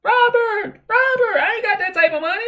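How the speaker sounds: put-on squeaky falsetto old man pleading he's broke; high cracked old-timer voice, quick and whiny; old man impression. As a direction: pitch it way up like a wheezy old man working on a car.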